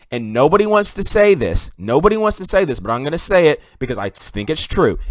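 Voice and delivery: usual speaking voice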